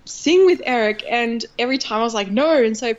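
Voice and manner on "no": retold firm no, higher and louder; stubborn refusal. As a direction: act out digging your heels in. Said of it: "I was really stubborn".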